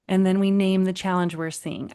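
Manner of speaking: ordinary talk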